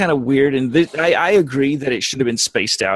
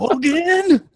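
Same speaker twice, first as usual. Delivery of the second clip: high barked call of Hogan; military officer; 14 semitones higher than usual. Pretend. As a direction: yell for the prisoner like Klink. Shouting for Hogan as Klink.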